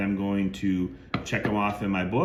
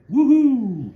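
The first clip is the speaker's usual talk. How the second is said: much higher, much louder whoop; excited woohoo; 10 dB louder than usual. Real, not acted. Collector cheering a blue Sharpie autograph, excited high loud whoop. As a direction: cheer like you hit the jackpot. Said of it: "gonna get a little excited".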